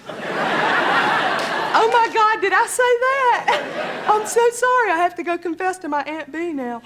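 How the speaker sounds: high, slow, laughing shocked apology. Retold or imitated. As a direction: gasp at what you just said, flustered. Horrified at her own rudeness.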